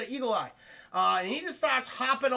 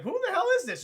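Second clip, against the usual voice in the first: much higher, panicky question; alarmed demand; 10 semitones higher, 3 dB louder, faster than usual. Acted. Chad demanding who is calling, high alarmed voice.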